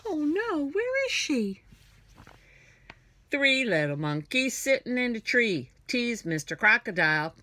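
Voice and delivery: usual speaking voice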